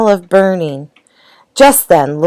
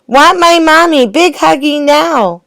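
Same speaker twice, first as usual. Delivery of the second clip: put-on toddler wailing; 11 semitones higher than usual. A narrator's reading. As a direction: whine like an upset toddler. Baby keeps demanding her mother.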